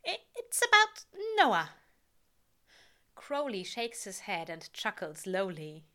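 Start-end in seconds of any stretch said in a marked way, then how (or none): 0.1-1.8 high, loud flustered excuse. A narrator's reading. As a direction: blurt the excuse, pitched up. Flustered lie.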